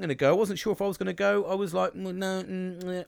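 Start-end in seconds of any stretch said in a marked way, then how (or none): 1.9-3.0 undecided hums, pitched up; hesitant mock hums, higher; umming and ahhing. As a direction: hum and haw, torn about going. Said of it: "I was umming and ahhing".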